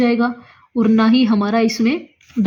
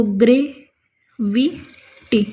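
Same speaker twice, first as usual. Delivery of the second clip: drawn-out dictation; much slower than usual. Shown as a demonstration. Teacher writing shorthand on the board, very slow. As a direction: stretch the word very slowly while writing. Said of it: "जैसे हमने लिख दिया gravity".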